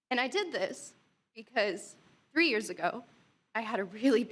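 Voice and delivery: ordinary talk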